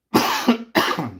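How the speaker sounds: loud hacking cough; chesty cough. Real, not acted. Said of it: "Sorry, I'm coughing again".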